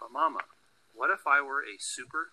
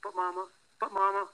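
put-on higher, insistent child calling Mama; 9 semitones higher, 4 dB louder than usual. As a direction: plead like an eager little kid. Child's pleading call, voiced higher.

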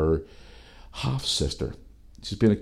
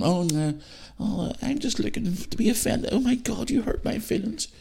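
much higher, softer mocking whine on "I'm just looking to be offended"; mock offended snowflake; 4 dB quieter than usual. Mocking easily offended people in a high whiny voice. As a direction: sneer it in a prissy high voice. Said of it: "These people are out there going".

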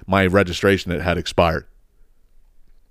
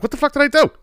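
much higher, baffled protest; incredulous outburst; faster than usual. Retold or imitated. Stopped by the cop, a high baffled protest. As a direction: yelp it in disbelief. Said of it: "I'm like".

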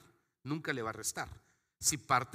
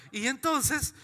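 laughing; 10 semitones higher, 4 dB louder, much slower than usual. Real, not acted. Laughs mid-sermon.

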